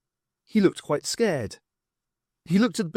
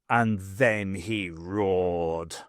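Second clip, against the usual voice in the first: lower, very slow build; dramatic slow narration; 7 semitones lower, much slower than usual. A narrator's reading. Narrator slows for the roar, low and slow. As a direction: savour the moment.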